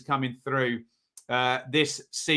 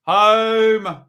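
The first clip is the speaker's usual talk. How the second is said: loud drawn-out call of home; announcer-style call; 5 semitones higher, 8 dB louder than usual. Real, not acted. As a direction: sing out the pick like an announcement. Calling his prediction.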